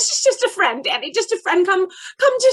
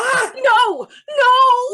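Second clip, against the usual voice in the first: frightened cry; 5 semitones higher than usual. Acted. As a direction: cry out in fear to stop him. Mother holds son back.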